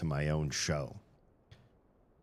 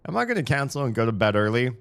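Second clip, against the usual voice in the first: fast anxious quote; 7 semitones higher, 7 dB louder, much faster than usual. Retold or imitated. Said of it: "I was like".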